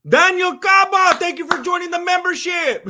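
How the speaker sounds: excited shout-out; loud shout-out. Real, not acted. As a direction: call his name across the room.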